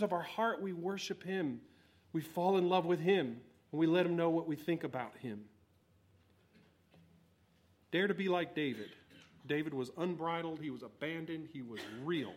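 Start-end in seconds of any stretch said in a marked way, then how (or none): none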